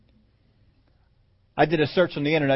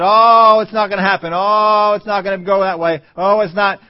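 mock wailing complaint; 4 semitones higher, 8 dB louder than usual. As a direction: wail it like a crowd giving up. Acting out the Israelites' despairing complaint.

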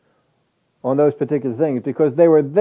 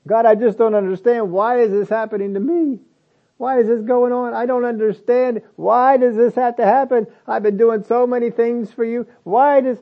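higher, whiny questioning of God; mock whining prayer; 8 semitones higher than usual. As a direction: imitate a puzzled, whining believer. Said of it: "Jesus doesn't whine and complain".

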